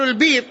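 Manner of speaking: long i in البير where the hamza was; البير with the hamza softened; hamza turned to ya. Shown as a demonstration. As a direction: say البير as "al-bīr", with no glottal stop. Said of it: "فيقال".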